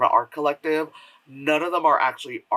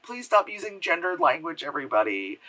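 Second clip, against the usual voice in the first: normal talking voice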